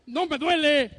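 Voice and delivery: a tough boy acting unhurt; defiant child. Defiant son after a spanking. (hijo desafiante tras la vara, en voz alta)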